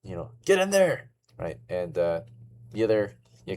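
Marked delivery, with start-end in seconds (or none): 0.4-1.1 high, loud barked command; a captain shoving his soldier through the door; captain's order. Acted. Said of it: "The captain's like".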